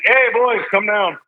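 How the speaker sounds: high, loud call up the stairs. Retold or imitated. Dad calling his sons down the stairs, loud and high. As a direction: shout up to the boys upstairs.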